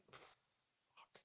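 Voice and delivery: breathless whisper; whispered curse on hearing the house is haunted. Retold or imitated. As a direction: barely voice it, a stunned whisper.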